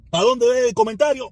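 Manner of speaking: loud, rising, playful question; mock crowd-follower. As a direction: ask it like someone eager to follow the crowd. Mocking the herd-following Cuban.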